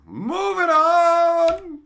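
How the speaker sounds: loud, high, stretched-out call; big announcement. Real, not acted. Streamer moves to the next card, loud announcer call. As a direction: belt it like a game-show host.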